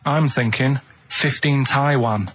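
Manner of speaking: ordinary delivery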